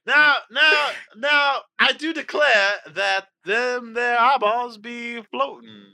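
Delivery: high, loud, slow blustery Southern rooster; hammed-up cartoon Southern rooster; Foghorn Leghorn impression. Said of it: "Foghorn Leghorn from Looney Tunes".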